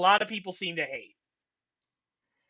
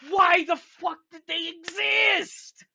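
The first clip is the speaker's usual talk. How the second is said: incredulous; 8 semitones higher than usual. Retold or imitated. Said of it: "So I'm like".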